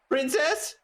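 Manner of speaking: high-pitched searching call; questioning call. Acted. Knight looking for the princess, questioning call.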